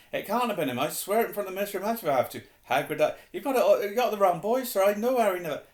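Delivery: loud, high, agitated outburst; Hagrid, ranting. A narrator's reading. Hagrid swears Harry is innocent, ranting. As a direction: rush on without letting anyone cut in. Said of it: "Hagrid went ranting on".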